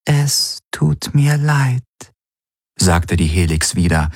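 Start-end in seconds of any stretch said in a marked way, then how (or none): 0.1-1.8 high, slow, mournful voice in his head; a regretful spirit voice, drawn out; higher, slow tragic apology; sorrowful inner voice. A narrator's reading. Helix repeats apology.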